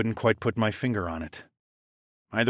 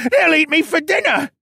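very high, loud panicked cry; frantic high-pitched wail; terrified shriek; 8 dB louder than usual. A narrator's reading. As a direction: scream it like prey about to be eaten. Captive shrieks in fear of wolves.

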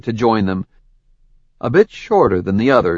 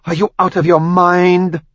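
higher, incredulous outrage; outraged; 9 semitones higher, 4 dB louder than usual. Acted. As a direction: hurl the question in disbelief. Samia outraged at Ash.

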